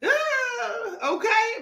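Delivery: high laughing ah; laughing exclamation. Real, not acted. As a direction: burst out amused. Bursting out after laugh.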